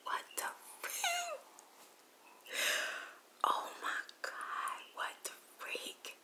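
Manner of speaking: slow, whispered disbelief; hushed shock. Retold or imitated. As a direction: whisper it slowly, stunned by what you see. Own shocked reaction while spying.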